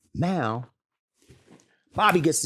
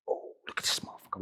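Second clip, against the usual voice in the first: whisper; 11 dB quieter, faster than usual. Retold or imitated. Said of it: "I was like".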